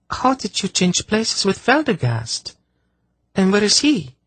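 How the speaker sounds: a hint of a puzzled girl asking; lightly voiced young girl, baffled. A narrator's reading. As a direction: ask like a confused young girl.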